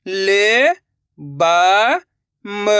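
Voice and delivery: ordinary talk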